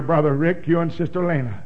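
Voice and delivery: ordinary talk, nothing marked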